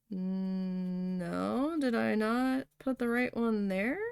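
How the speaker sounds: long drawn-out hum into a tentative question; hesitant guess. Retold or imitated. Retelling an answer to the boss about the bush, hesitant. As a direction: stall on "Mm", then rise uncertainly at the end.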